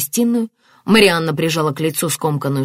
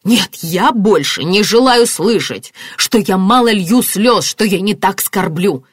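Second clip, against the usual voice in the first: slow, loud, emphatic anger; furious protest; 4 semitones higher, 6 dB louder, slower than usual. A narrator's reading. Martin rejecting accusations that he grieves less, furious. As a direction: refuse to hear it, hammering phrases. Martin rages at his wife.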